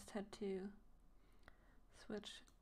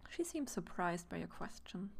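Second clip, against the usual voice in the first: usual speaking voice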